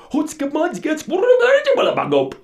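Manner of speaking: high, loud, very slow wailing chant; panicked sailors crying a backwards prayer; garbled prayer cry. Acted. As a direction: howl the prayer inside out. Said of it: "They cried".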